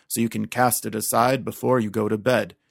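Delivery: usual speaking voice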